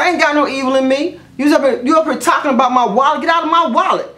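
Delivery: high-pitched arguing back; retold indignation. Vlogger telling off the nosy customer, retold indignation. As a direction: act out a heated comeback, pitch climbing. Retold quarrel over wallet.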